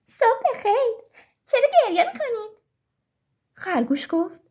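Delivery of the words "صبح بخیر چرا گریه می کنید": high, loud little story bee; bee character. A narrator's reading. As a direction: pipe up as a bee greeting the criers. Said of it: "بالای سرشون پرواز کرد و گفت".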